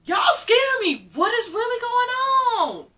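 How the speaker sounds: high, loud frightened outcry; scared disbelief, voice shooting high; alarmed. Retold or imitated. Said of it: "I'm like".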